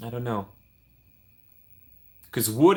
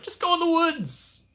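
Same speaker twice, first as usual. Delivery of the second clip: high, slow mock parent voice; mock carefree parent shooing a child out; slower than usual. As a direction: airily send the kid off, pitched way up. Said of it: "They're like".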